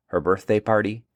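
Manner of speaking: ordinary delivery, nothing marked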